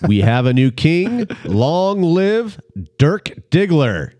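slow, high, grand announcement; royal proclamation. Pretend. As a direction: proclaim it like crowning a king. Crowning donor as new king.

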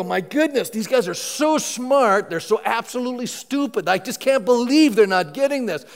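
incredulous outburst, pitched high; acted exasperation. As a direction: exclaim in mock disbelief, stretching the key words. Acted exasperation at smart, stupid people.

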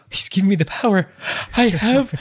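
high, hammed-up awestruck proclamation; He-Man. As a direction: build up like a cartoon hero's cry. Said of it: "I was like He-Man".

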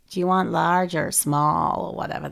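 put-on half-hearted American accent, low and soft; attempted American accent. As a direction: try a slightly Texan American accent. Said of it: "I can't do the American accent".

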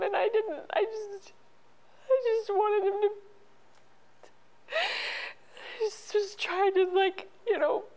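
slowing down, choked with tears; crying. Real, not acted. Grieving mother recalling staying with her son, crying. Recalling not wanting to leave.

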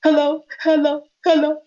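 high tearful phone voice, acted; imitated crying. Retelling a phone call to Brother Kemet, mock crying. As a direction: imitate answering the phone in tears. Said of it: "I was crying".

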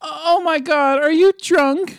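put-on slow whiny teen girl; Summer voice. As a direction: whine it slowly.

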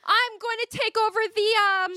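put-on squeaky girl declaring her plan; mock heroine voice. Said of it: "She's like".

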